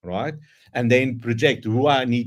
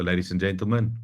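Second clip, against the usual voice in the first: ordinary talk, nothing marked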